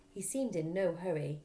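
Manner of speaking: plain reading voice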